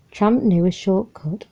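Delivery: plain reading voice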